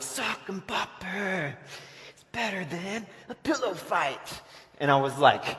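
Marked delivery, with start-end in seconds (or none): 0.0-4.4 slow, high hammed-up ad announcer; lightly mock the toy commercial pitch. Kids in the TV ad selling sock 'em boppers, slow hammed-up announcer. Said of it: "they'd be like".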